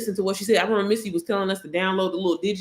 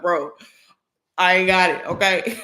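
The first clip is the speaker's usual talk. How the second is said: exasperated; 3 dB louder than usual. Retold or imitated. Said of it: "I was like".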